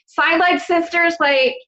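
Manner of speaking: ordinary talk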